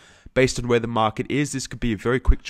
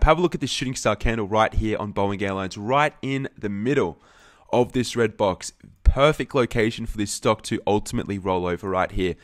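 ordinary delivery

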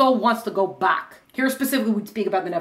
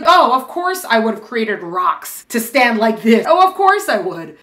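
slow, louder, self-satisfied know-it-all voice; mock smugness; 5 dB louder, slower than usual. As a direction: drawl it like it's obvious. Mocking smug know-it-all.

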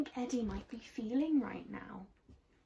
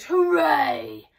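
loud, raised bear hooray; bellowed cheer; 5 semitones higher, 11 dB louder than usual. A narrator's reading. Hungry bear cheers at the food, bellowing. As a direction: bellow it out in delight. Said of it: "he bellowed".

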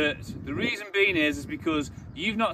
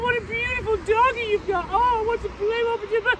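put-on high, sing-song baby talk to a dog; silly baby voice. Mocking dog owners cooing at a puppy in silly baby talk. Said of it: "all this silly little voice".

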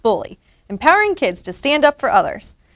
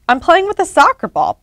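higher-pitched testy retort; snapping; 6 semitones higher, 4 dB louder than usual. A narrator's reading. Pete to Ruby questioning him, higher snappy retort. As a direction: snap back, pitched up. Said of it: "Pete snapped".

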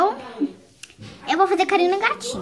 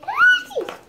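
shocked; 12 semitones higher than usual. Pretend. Girl shocked by what she saw, high disgusted cry. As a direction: recoil in horror.

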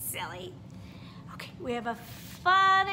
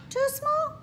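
much higher, asking; rising question; 4 dB louder than usual. Acted. Teacher asks the children if the studio fits, high question. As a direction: wonder if it fits.

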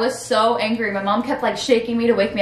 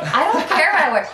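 higher, laughing through a bratty retort; laughing bratty reply; 6 semitones higher than usual. Retold or imitated. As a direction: toss it off with a laugh, pitched up.

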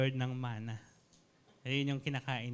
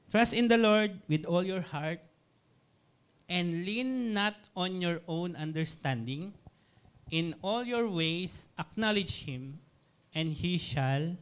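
scripture reading; 3 semitones higher, 3 dB louder, slower than usual. Acted.

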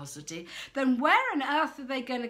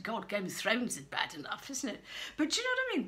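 ordinary talk, nothing marked

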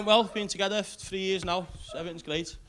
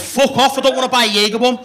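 much louder, higher furious shout; Tony telling the seller off, yelling furiously; angry yell; 5 semitones higher, 14 dB louder than usual. Retold or imitated. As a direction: blow up at him. Tony loses his temper.

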